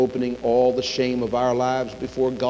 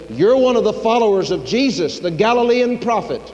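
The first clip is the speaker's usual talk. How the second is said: raised, higher accusing voice; acted accusation; 8 semitones higher, 3 dB louder than usual. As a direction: confront him as an accuser would. Accuser confronts Peter in story.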